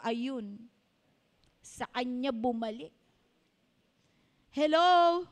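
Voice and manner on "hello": loud call out. Real, not acted. Rhetorical call to congregation.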